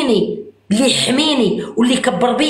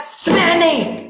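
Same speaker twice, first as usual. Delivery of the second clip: harsh tone; 5 semitones higher, much slower than usual. Real, not acted. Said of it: "وما تهضريش معايا بهاد الطريقة هادي".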